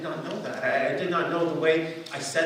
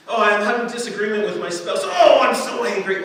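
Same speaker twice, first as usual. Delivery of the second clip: mock anger; 5 semitones higher, 9 dB louder than usual. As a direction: ham up an angry spouse. Mock angry spouse quarrel.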